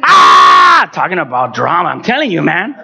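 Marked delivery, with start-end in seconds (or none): 0.0-0.9 high, loud cathartic scream. Retold or imitated. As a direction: scream out bitterness in a reenactment. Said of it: "I just got to scream".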